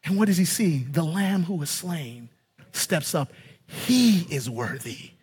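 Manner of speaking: low, soft, slowing to the last word; hushed reverence. Real, not acted. Preacher revealing the slain Lamb, hushed and reverent. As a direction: drop to a solemn hush.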